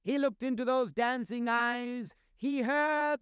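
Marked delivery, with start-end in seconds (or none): none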